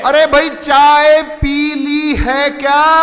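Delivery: high, slow, full-voiced yell; father shouting. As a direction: holler the question with long vowels. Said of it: "फिर से पापा चिल्लाए".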